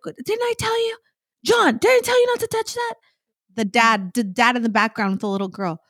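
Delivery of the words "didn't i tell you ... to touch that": high, scolding parent voice; shrill-pitched nagging mother imitation; mock scolding mom. Mother scolding her toddler in the library, high mock scold.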